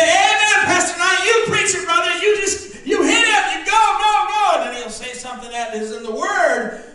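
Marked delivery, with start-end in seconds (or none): 0.0-4.5 high shouted amen, building up; excited amen. Retold or imitated. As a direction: cheer the preacher on. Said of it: "and say".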